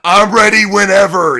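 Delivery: loud taunting "I'm ready whenever"; mocking challenge. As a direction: throw it out as a loud cocky dare. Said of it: "made fun of him and replied".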